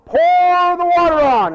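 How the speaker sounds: high, drawn-out loud order; commanding shout. Acted. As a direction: holler the order to the whole crowd. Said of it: "He says".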